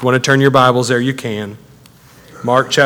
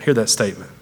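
usual speaking voice

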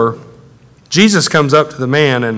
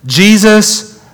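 raised, higher-pitched cry; 7 semitones higher, 4 dB louder than usual. Acted. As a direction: call out to Jesus in appeal. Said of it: "the boy's father cried out".

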